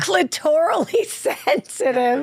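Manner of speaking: slow, incredulous laughing echo; laughing disbelief. Real, not acted. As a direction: repeat it slowly, laughing in disbelief. Repeating friend's shocking phrase.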